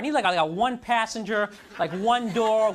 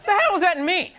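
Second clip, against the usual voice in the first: exasperated; 9 semitones higher, 7 dB louder than usual. Real, not acted. Reacting to the date's faces.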